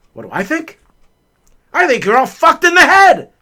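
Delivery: Clark snapping. Retold or imitated. Dad losing it at his whining family, furious yell. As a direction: blow up at the family. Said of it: "he's swearing".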